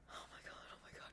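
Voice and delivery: breathless whisper, barely audible; panicked whisper. Retold or imitated. On the ride, she whispers to herself in panic. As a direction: whisper it to yourself in dread.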